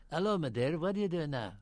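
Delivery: put-on light Plymouth accent, West Country burr; a hint of Plymouth accent on a comic entrance. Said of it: "one of the more rare British accents, which is a Plymouth accent".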